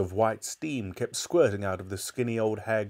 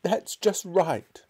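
high, slow urgent cry; 9 semitones higher, 4 dB louder, much slower than usual. A narrator's reading. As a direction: stretch it out, pitched high. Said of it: "cried Missus Cranky".

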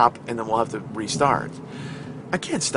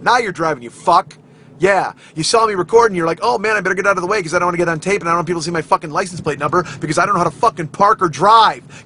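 loud, raised rant building to a yell; put-on road rage; 4 semitones higher, 5 dB louder than usual. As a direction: play up an angry driver's tirade. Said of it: "that's me putting on a little show".

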